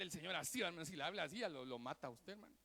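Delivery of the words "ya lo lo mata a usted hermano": usual speaking voice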